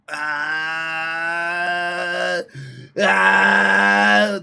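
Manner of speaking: long strained cry of exhaustion; drawn-out pleading groan. Real, not acted. Worn-out streamer groaning to chat for energy, strained cry. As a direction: groan it out like you're running on empty.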